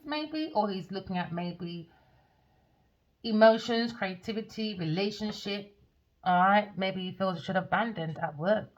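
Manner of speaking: usual speaking voice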